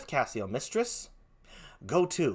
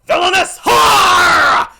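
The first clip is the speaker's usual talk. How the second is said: very high, loud, slow bellow; husband screams at his wife, full force; savage shout; 14 dB louder, much slower than usual. Acted. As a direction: spit it at her. Iago attacks wife.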